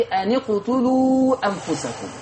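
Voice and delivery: slow, measured recitation of the verse; تلاوة مرتلة بطيئة; Quranic recitation. Shown as a demonstration. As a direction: recite it with tajweed, unhurried. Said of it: "الآية ستة وستين عندي".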